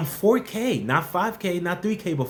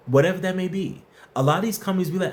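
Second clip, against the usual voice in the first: ordinary talk, nothing marked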